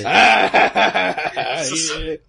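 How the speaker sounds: big burst of laughter; cracking up; loud laugh. Real, not acted. Friend hurt, others laughing.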